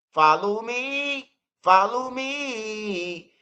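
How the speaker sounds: drawn-out, very slow come-along call; mock slow summons. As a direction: stretch every word like luring him in. Leading friend to the van, mocking.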